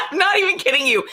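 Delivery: high, laughing with excitement; giggling through the words in disbelief. Real, not acted. To her stream viewers, laughing with giddy excitement.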